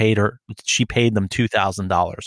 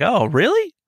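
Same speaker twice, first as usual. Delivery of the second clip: high, wide-eyed fake innocence; stringing the scammer along in a falsely eager voice; mock naive surprise; 6 semitones higher than usual. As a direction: play dumb, all high and eager. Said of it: "And I'm like".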